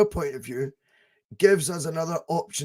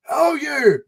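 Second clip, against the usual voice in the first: loud, high-pitched mock call; imitating the guy; 9 semitones higher, 6 dB louder than usual. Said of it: "not in an English accent".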